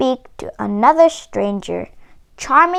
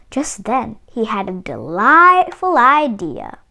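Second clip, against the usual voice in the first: plain reading voice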